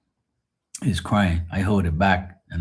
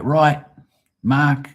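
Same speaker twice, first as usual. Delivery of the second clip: higher-pitched mock British accent; English teacher; 6 semitones higher, 4 dB louder, slower than usual. Imitating an English teacher telling him off, English accent. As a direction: chivvy the pupil in a put-on English accent. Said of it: "And he was from England".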